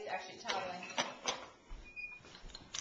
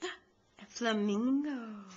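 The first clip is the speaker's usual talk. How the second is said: slow, drawn-out amazed flamingo; hammed-up wonder; mock gasp; much slower than usual. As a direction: gasp and marvel for the baby. Gasping reveal to baby.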